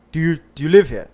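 a mock suspicious resident questioning him; retold nosy neighbour. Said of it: "and she's like".

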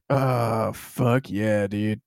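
put-on drunk dude voice. Drunk self at 3am, low voice.

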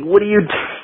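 squeaky high "what are you"; incredulous. Retold or imitated. Guitarist watching engineer slice tape, high mock disbelief. His own incredulous reaction retold.

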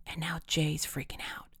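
hushed report to a friend; voiceless, soft whisper. A narrator's reading. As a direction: whisper the news from a hiding place. Hidden friend whispers an explanation.

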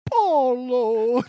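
high, hammed-up Italian Mario voice; over-the-top Italian Mario impression; mock Italian. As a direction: camp Italian cartoon accent, drawn out slow. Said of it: "literally a Mario character right now".

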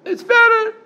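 BETTER very high and loud; telling inflection. Shown as a demonstration. Rabbi showing inflection says a lot, telling high inflection on BETTER. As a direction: make BETTER soar up, loud and pointed. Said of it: "the inflection of the voice says a lot".

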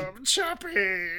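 high, slow, swooning creature voice; badly faked robot, long and high; Chappie. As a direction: drag the name out in a silly moan. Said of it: "That's not what he sounds like".

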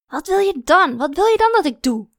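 high, loud frustrated outburst; exasperated near-scream. A narrator's reading. Woman nearly screaming at a glaring child, exasperated. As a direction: let the pent-up frustration burst out. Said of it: "Bijna had ik dat tegen haar geschreeuwd".

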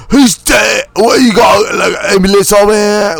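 very high, shouted British caricature; fake British accent. Co-host as bystander at a death, shouted fake British accent. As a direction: yell it out in a bad British accent. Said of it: "fake British accents".

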